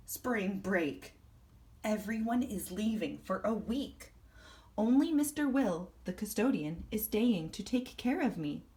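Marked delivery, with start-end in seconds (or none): none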